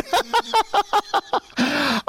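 high, rattling cackle; laughing. Real, not acted. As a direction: crack up at your own punchline. Laughs at the punchline.

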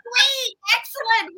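high, thrilled squeal of approval; delighted. Retold or imitated. To a friend admitting anger, a high delighted "great". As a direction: cheer their anger like it's great news. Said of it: "because I'm like".

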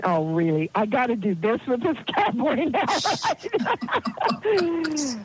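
half-laughing mock disbelief, rising at the end; a touch of amused exasperation; incredulous. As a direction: lightly play your own baffled self, laughing through it. Retelling her disbelief at the horse's request.

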